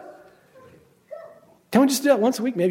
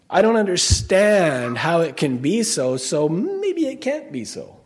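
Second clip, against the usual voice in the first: mock unbelief; slower than usual. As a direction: stretch out the sceptic's reasoning. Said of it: "evil heart of unbelief".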